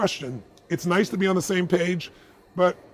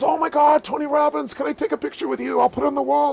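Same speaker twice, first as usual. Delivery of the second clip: high, loud gushing fan; excited fan; 11 semitones higher, 4 dB louder than usual. Acted. Shop guy asks famous customer for a picture, excited, high and loud. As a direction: gush like a starstruck shop guy. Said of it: "and the guy goes".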